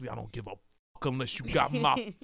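mock American street tough, starting low; Damson Idris in Snowfall. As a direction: play an American TV drug dealer. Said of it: "comes in and plays Snowfall".

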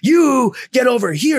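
high, slow, loud command; mock yell. Shouting back at his team leader to come over, slow mock yell.

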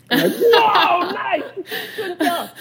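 high laughing whoa, nice, good job. Real, not acted. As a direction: crack up and squeal out praise. Laughing at daughter's bold question.

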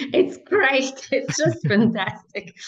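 laughing, high and bright praise; gushing through a laugh. Real, not acted. Laughing about playing pickleball.

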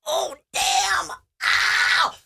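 high cry on banging an elbow; acted yelp of pain. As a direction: imitate a sudden painful knock. Re-enacts hitting her elbow.

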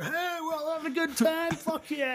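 high, soft, happy boater voice; cheery high voice. Retold or imitated. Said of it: "It's like".

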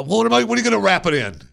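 high, fast and flustered. Retold or imitated. Arguing with the wife about wrapping the bird, high and flustered. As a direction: rattle it off in a panic.

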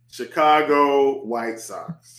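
drawn out slowly, word by word; slow repeat. Real, not acted. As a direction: repeat it at half speed, savouring each word. Same pick repeated slowly after passionate take.

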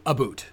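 "a boot" with a long oo. Shown as a demonstration. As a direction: say "a boot", vowel like boot. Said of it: "We are accused of saying".

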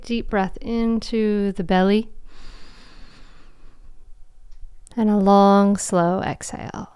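guided meditation. Acted. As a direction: guide them into calm, with long pauses.

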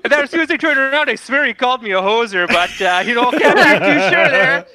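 mock-Canadian barroom witness; hammed-up Canadian accent; Canadian mechanic. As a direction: tell a rambling Canadian yarn. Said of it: "They're, they're Canadian?".

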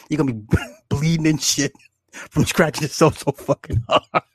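words broken up by real laughter; cracking up telling the scrubbing story; laughing while talking. Real, not acted. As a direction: push the lines through giggles. Laughing while describing scrubbing scene.

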